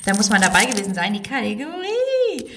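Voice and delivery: leicht nachgemachte Lotta, Ende hoch und gedehnt; wie Lotta. Retold or imitated. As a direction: Lotta nachmachen, letzte Worte hoch gezogen. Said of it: "so wie Lotta sagen würde". (Lotta angedeutet nachgeahmt, Ende sehr hoch)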